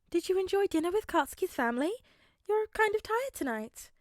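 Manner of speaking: high, gentle mother checking on son; caring mother. A narrator's reading. Mother's concerned question.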